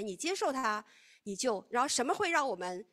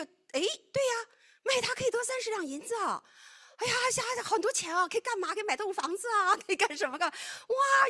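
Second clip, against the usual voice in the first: excited greed; 11 semitones higher, 5 dB louder than usual. Retold or imitated. Judas's greedy thoughts voiced.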